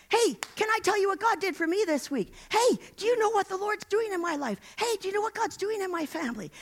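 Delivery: eager testimony. Acted. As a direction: burst out with what God did. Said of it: "tell their story".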